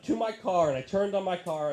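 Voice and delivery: ordinary talk, nothing marked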